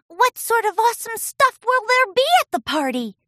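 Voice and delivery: put-on high, loud kid voice; eager filly question. Scootaloo asking Pinkie, high eager voice. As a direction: ask eagerly. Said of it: "asked Scootaloo".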